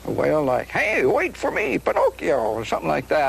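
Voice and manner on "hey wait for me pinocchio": Jiminy Cricket voice. Shown as a demonstration. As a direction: call out high as a cricket. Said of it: "Let's hear Jiminy Cricket".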